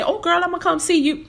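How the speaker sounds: high, breezy fake-friendly voice; sing-song it like a promise you won't keep; mock empty promise. Imitating Americans who promise to come, high and breezy. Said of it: "which people in America always say".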